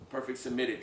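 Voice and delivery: ordinary delivery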